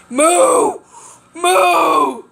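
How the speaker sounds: loud high shouted Moo; shouting. Acted. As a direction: bellow the word out. Shouting the name as sign-off.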